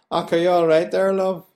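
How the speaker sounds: friendly Belfast man greeting a stranger. Retold or imitated. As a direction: greet a stranger like an old pal. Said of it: "a man to say to a woman".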